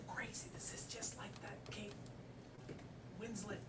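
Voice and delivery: excited hushed whisper; whisper, freaked out. Acted. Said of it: "why are you whispering?".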